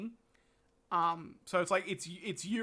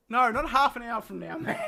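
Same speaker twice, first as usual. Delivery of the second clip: louder, half-laughing no; laughing protest; 8 dB louder than usual. Real, not acted. Streamer protesting to Mac, loud and laughing. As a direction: push back on the friend with a laugh.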